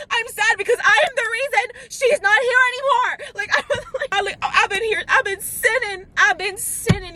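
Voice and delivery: high, hammed-up tearful wail; sobbing. Girl blaming herself for grandma's death, mock sobbing high-pitched wail. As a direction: imitate a guilt-ridden sobbing confession. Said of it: "I was sobbing so much".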